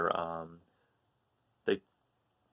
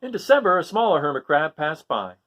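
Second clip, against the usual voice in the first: lively picture-book reading, rising on each list item; story narration; 5 semitones higher, 7 dB louder than usual. A narrator's reading. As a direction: read it to children, animated and sing-song. Reads picture book aloud.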